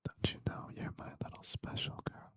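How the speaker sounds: fast breathy ear whisper; whispered in your ear. Acted. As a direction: lean in and whisper it. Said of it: "when I whisper in your ear".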